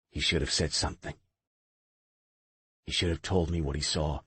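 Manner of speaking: low, quiet, scraping from the throat; scraped-out voice. Acted. Knox answers Marina about his brother, low and scraped. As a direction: force the words out low and rough. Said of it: "his voice scraped out of his throat".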